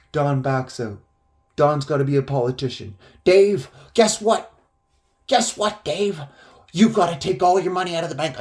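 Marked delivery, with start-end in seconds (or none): 3.2-8.4 higher, insistent pitch to Dave; urgent pestering. Retold or imitated. Don urging Dave to empty his account, urgent pestering. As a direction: imitate someone pushing breathless news on a buddy.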